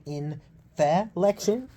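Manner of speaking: "inflection" with an extra vowel between f and l; split-up pronunciation. Shown as a demonstration. Asking how you say it, broken into slow syllables. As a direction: say "inflection" slowly as in-feh-lection, rising at the end. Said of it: "Do you say inflection?".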